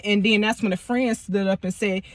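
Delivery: normal talking voice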